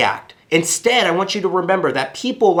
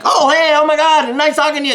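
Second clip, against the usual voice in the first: much higher and louder, hyper-cheerful; overexcited greeting; 12 semitones higher, 5 dB louder than usual. Shown as a demonstration. Caller startling a half-asleep prospect, overexcited. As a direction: blast a bubbly hello at a sleepy person. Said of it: "and you're like".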